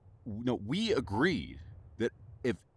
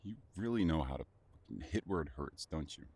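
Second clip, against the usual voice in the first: low and much softer, fast then fading; wounded, almost to yourself; quiet bitter resignation; bitter hurt; 8 semitones lower, 9 dB quieter, faster than usual. Acted.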